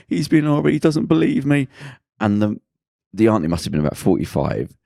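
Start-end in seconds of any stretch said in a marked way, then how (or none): 0.1-1.7 imitating a crying girl, pitched up; higher, lightly acted tearful whine; mock tearful complaint. Crying girl complaining to her auntie, mock tearful. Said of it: "and she's crying".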